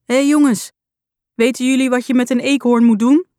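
a phone voice calling out to the others, a bit muffled. Acted. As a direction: shout to colleagues away from the phone. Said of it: "De stem schreeuwt".

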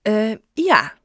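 awkward, halting admission; stammered. A narrator's reading. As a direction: falter on the um before a wavering yes. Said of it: "stamelde Joe".

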